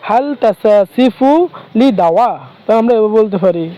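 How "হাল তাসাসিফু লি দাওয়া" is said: slow careful demo. Shown as a demonstration. Teacher models asking about a prescription, slowly and clearly. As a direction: say হাল তাসাসিফু লি দাওয়া slowly, lifted a little. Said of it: "তাহলে কীভাবে বলুন দেখেন".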